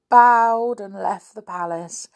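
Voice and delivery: ordinary delivery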